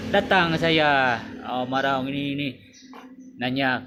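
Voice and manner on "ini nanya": ordinary talk, nothing marked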